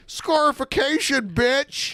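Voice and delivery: high cocky shout; mock boast. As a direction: brag it playfully. Mock boast after laugh.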